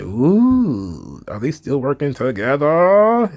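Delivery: drawn-out "ooh" and rising question; mock intrigued gossip. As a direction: stretch it out like juicy gossip. Imitates gossipy reaction to reunion.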